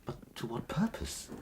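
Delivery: hushed whisper. Acted. Captain asks the weak stranger softly, whispering. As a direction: ask it in a whisper at the bedside. Quiet question at bedside.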